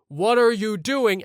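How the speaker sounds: loud, high, slow mock yell; imitated teacher's yell. Teacher shouting at Kyle by the window, imitated yell. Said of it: "is the teacher yelling".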